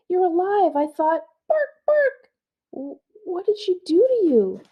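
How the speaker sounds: put-on high girl's voice, worried; relieved girl. Girl relieved her dog lives, asks what the witch did. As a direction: gasp it out, relieved and scared.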